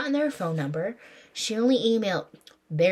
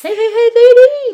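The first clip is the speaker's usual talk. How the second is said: very high, loud chipmunk squeal; 17 dB louder than usual. Retold or imitated. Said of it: "he got a little chipmunk voice".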